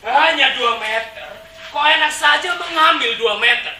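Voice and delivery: loud, high indignant retort; astonished protest. Acted. Residents objecting to losing two metres, loud astonished protest. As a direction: throw it back in shocked protest. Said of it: "Warga kami tercengang".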